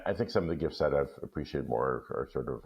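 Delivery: usual speaking voice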